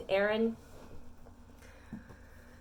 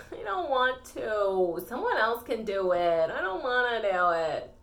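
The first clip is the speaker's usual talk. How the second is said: drawn-out mock whine; whining; 3 dB louder than usual. Child refusing the parents' chores, hammed-up whine. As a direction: whine like a kid dodging chores. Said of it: "do you ever whine about it?".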